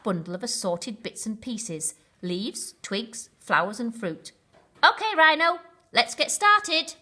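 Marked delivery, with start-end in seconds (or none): 4.8-6.9 bright, higher and louder, smiling. A narrator's reading. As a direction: cheerfully start the job, grinning. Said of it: "he said with a smile".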